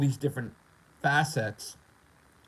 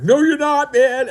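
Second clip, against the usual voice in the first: much higher, louder playful yell; mock shout; 16 dB louder, slower than usual. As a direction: yell back at himself in jest. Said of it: "And I'm like".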